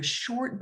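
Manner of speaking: "short" singled out with deliberate emphasis; stress on short. Real, not acted. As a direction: lean on the word "short". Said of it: "I emphasize the word short".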